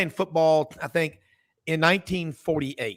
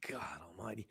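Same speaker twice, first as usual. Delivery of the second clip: whisper; 6 semitones lower, 21 dB quieter, much faster than usual. Real, not acted. Awed reaction to a ninety-first birthday.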